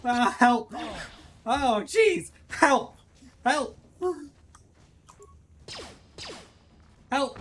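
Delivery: high, loud yelps; short, high panicked shouts; panicked cries for help. Real, not acted. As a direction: yelp for help in a game panic. Player in danger, calling for help.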